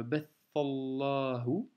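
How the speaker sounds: bathallahu read slowly in one linked breath; with liaison. Shown as a demonstration. Teacher showing the liaison, slowly. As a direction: link bathallahu slowly, no break. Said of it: "Donc tu fais la liaison".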